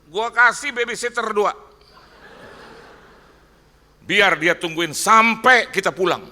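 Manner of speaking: high, booming imitation of the boss; acted boss shouting emphatically; boss's loud offer. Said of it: "Dia bilang begini".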